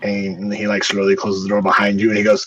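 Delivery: ordinary delivery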